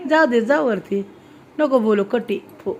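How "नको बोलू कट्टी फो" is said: normal talking voice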